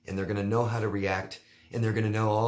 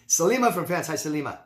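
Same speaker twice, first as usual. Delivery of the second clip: higher and louder, announcing the caller; bright upbeat intro; 8 semitones higher, 6 dB louder, faster than usual. Real, not acted. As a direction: call out the name like a show host. Introducing a listener question.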